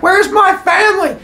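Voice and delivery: high, panicked mock shout; freaked-out yell. Father confronts monster over missing family, high freaked-out cry.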